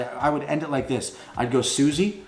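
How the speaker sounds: ordinary delivery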